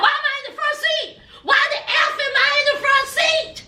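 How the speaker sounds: high, fast, shouted complaint; mock furious shouting mama; angry mama. Devin's mama yelling about the front seat. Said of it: "Devin's mama talking about".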